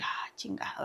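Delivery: voiceless under-the-breath curse of dismay; muttered curse. Retold or imitated. Caught by her brother-in-law, she breathes a voiceless curse.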